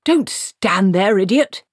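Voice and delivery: loud, slow, exasperated yell; angry shout. Acted. Soaked archchancellor yelling at Treatle, angry and loud. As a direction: shout at the idiot to move.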